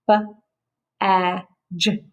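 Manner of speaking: "B-A-J" as three separate sounds, a pause after each; sounded out. Shown as a demonstration. Teacher tapping out the sounds of badge for pupils, sounded out. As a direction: sound out "B-A-J" sound by sound, not letter names. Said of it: "Let's sound it out. Tap it out".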